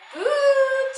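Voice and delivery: put-on very high squeaky child answer; puppet child voice. Kid puppet answering teacher in high squeak. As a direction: pipe up brightly like a tiny child.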